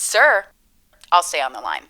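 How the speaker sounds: usual speaking voice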